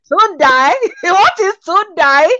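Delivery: laughing, incredulous "Tundai", stressed like die; mock the name through laughter; laughing mockery. Questioning a Nigerian's anglicised name, laughing mockery.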